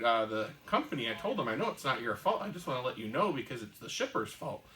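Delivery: ordinary delivery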